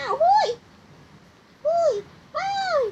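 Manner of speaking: high, sharp calls of objection; short high-pitched hollers; protesting shouts. Acted. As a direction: yelp out to stop someone. Protesting being dragged onto ride.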